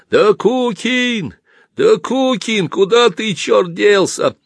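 drawn-out high-pitched calling; bawling. Acted. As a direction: holler for a missing man, stretching the name. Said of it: "кто-то орал рядом". (протяжно орёт, высоким голосом)

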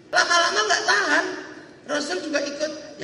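ordinary delivery

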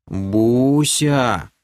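ласковое прозвище с чувством; протяни имя с нежностью. Acted. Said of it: "с чувством произнёс лысый эльф". (с чувством, нараспев)

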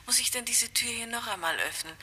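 usual speaking voice

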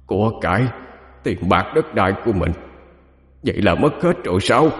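hushed, disbelieving stammer; stunned stammering. A narrator's reading. Dead man realises he has lost everything, stammering in disbelief. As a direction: falter softly in shock, words broken by pauses. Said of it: "ông Năm trung trung lắp bắp".

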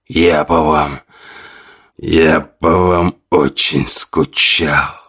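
undead voice. A narrator's reading. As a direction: drag it out like a corpse. The undead husband keeps speaking.

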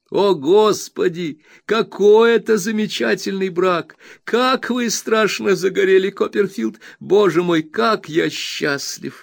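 high, loud rapturous outburst; exclaiming. A narrator's reading. Traddles praises his marriage to Copperfield, rapturous high exclamation. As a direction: overflow with happiness. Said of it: "воскликнул Трэддлс".

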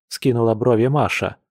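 plain reading voice

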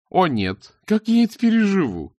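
mock dismay, rising high on the question; mocking scoff. A narrator's reading. Priscilla mocks her mother's anger with a scoff. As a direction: scoff at her, pretending to care. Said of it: "хмыкнула в ответ Присцилла".

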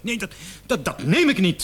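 high, loud, stammering indignation; a suspect told he could be the maniac, high and loud; indignant protest. Acted. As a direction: refuse it outright, flustered and offended.

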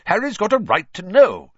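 high, loud insistence; heated. A narrator's reading. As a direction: argue it forcefully as father. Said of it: "was saying heatedly".

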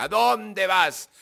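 high, loud, stretched-out shout; imitated angry yell. Father shouting at the son who walks away, angry yell. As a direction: imitate a father yelling after someone walking off. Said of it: "que me gritó".

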